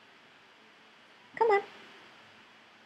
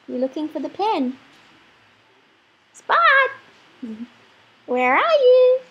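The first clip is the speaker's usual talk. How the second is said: excited calling; 8 semitones higher, 4 dB louder, slower than usual. Real, not acted.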